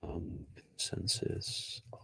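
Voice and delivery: slow, near-whispered mumble while searching; muttering to oneself. Real, not acted. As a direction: murmur it under your breath as you look.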